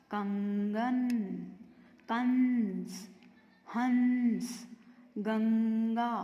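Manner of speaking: कंगन, कंस, हंस, गंगा with long hummed nasals; कंगन, कंस, हंस, गंगा slowly, through the nose; nasal anusvar. Shown as a demonstration. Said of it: "नाक से आवाज़ आती है".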